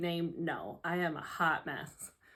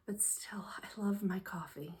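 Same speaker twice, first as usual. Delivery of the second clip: whispering; 8 dB quieter than usual. Real, not acted. Confides coffee love in whisper.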